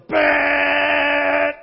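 loud mock horn, like a game-show buzzer; car horn blast. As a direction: blast it like an impatient driver's horn. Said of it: "like a Family Feud buzzer".

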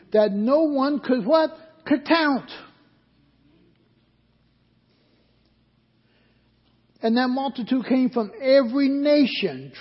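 full-force preaching. Acted. Pastor reading Revelation to the church, full-force preaching. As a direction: preach it out, building to the congregation.

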